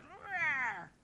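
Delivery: a small, high mock cub roar; little lion cub roar. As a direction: squeak out a tiny lion roar. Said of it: "There's a little kinda lion cub roar".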